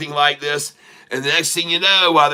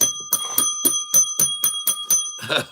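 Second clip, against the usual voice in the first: high squealing laugh; 5 dB quieter than usual. Real, not acted. Laughing at his own joke.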